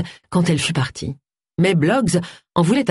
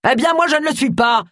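much higher, exasperated mock yell; indignant yell; 10 semitones higher than usual. A narrator's reading. Vicar shut out of his bathroom, indignant yell. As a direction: yell it in outrage without real volume. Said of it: "hurla le pasteur".

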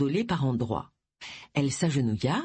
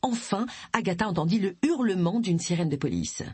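ordinary delivery, nothing marked

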